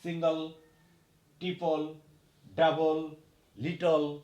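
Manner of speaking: সিঙ্গল, ট্রিপল, ডাবল, লিটল each ending in a plain "ল" sound; final -le as "ল". Shown as a demonstration. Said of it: "ল উচ্চারণ করতে হবে".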